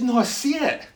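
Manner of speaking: high, emphatic conviction; awed insistence. Retold or imitated. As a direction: insist on the wonder before you. Retold man insists on vision.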